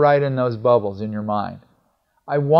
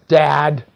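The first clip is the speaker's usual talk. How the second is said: Dad said louder, leaning on it; stress on dad; 5 dB louder than usual. Retold or imitated. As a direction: call out Dad with weight. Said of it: "notice he's emphasizing dad".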